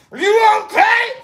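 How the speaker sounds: high shouted question; shouting over music. Retold or imitated. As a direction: yell it over the noise of the pit.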